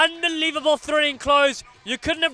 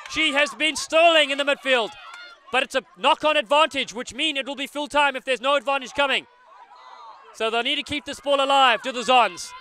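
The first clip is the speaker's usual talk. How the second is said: usual speaking voice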